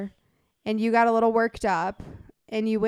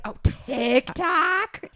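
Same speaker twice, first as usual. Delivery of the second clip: disgusted; 6 semitones higher, much slower than usual. Retold or imitated. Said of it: "and he's like".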